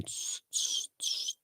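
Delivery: voiceless 'tss' clicks copying a mouse scraping; quiet imitation of a scratchy mouse sound; imitated mouse-pad hiss. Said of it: "It was going".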